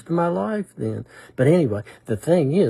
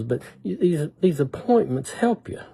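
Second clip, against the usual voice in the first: ordinary delivery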